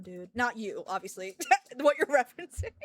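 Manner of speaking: usual speaking voice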